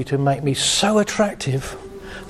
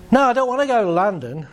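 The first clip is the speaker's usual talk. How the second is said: higher, fast, irritated protest; exasperated driver; 7 semitones higher, faster than usual. Acted. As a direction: push back at the car, fed up. Driver arguing with car.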